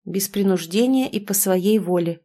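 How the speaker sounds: usual speaking voice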